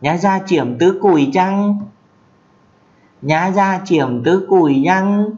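"Nhá ra chiểm tứ cùi chăng?" lên cao, ngân; hỏi bằng tiếng Mường, giọng cao; Mường question. Shown as a demonstration. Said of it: "Thì dịch ra là". (câu hỏi Mường cao, chậm dần)

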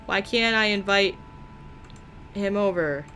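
usual speaking voice

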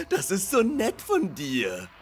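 high, gushing and tearful; überschwänglich gerührt. Acted. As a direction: build from touched to loud, overwhelmed gratitude. Gerührt wegen Taschentuchberg. (weinerlich gerührt, immer lauter)

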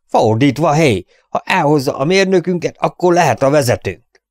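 loud, high shouted interruption; shouting in. Acted. Freckled man shouting down Robin's offer, loud interruption. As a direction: yell it over the crowd. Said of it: "ordított közbe".

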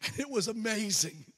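usual speaking voice

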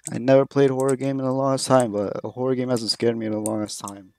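ordinary talk, nothing marked

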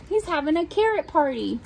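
usual speaking voice

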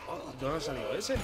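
startled shout. Real, not acted. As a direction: jump out of your skin. Aparece un enemigo de repente. (grito de sorpresa)

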